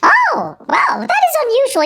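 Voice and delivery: squeaky high shocked wow; surprised. Acted. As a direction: shoot way up in surprise. HR worker reacts to news.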